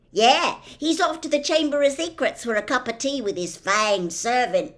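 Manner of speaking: loud high teasing, a touch of a chuckle; mock it up for laughs; chortling.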